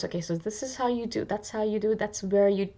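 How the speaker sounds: soft muttering as if taking notes; hushed, studious self-talk; muttered notes to self. Pretend. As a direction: quietly sound out the notes to yourself.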